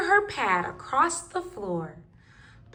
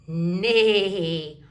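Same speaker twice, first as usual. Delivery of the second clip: horse neigh; 7 semitones lower than usual. Pretend. Animal sounds, blue horse.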